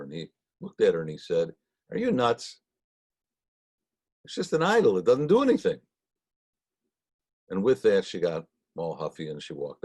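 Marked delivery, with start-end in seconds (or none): 1.9-5.8 exasperated retort. Retold or imitated. Abraham scolding the customer.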